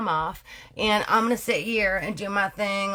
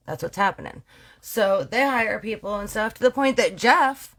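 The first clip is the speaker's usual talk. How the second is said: usual speaking voice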